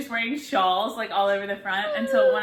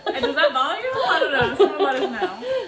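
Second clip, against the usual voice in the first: laughing; 6 semitones higher, 9 dB louder than usual. Real, not acted. Laughing through the line.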